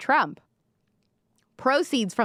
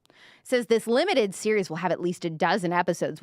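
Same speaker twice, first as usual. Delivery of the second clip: usual speaking voice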